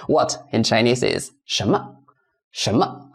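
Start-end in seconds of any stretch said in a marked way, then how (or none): 2.5-3.1 什么 with 什 rising, 么 light; second tone. Shown as a demonstration. Teacher demonstrating "what" in Chinese, 什么 with rising second tone. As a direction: lift the pitch through 什 in 什么, then drop lightly. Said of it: "Second tone".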